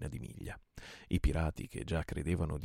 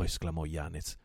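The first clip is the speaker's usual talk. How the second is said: usual speaking voice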